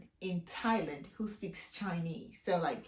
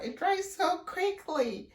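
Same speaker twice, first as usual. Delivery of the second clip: surprised; 11 semitones higher, 4 dB louder, slower than usual. Real, not acted. Surprised at hair drying.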